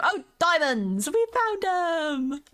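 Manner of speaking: thrilled finding diamonds; excited. Real, not acted. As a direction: shout out the find.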